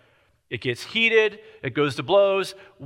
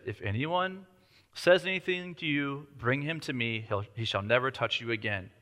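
much softer, low reassurance; calm king; 4 semitones lower, 7 dB quieter than usual. Retold or imitated. King calmly reassuring the widow, softly. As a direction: settle her quietly as a king would.

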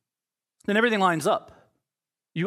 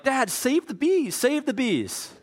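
put-on child pleading; 8 semitones higher, slower than usual. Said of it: "one of my kids is like".

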